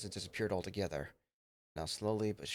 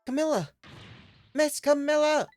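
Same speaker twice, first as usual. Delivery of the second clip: much higher, much louder frantic calls; desperate calling; 12 dB louder than usual. Acted. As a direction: yell for her across the flooding room. Dog calls out for Camilla.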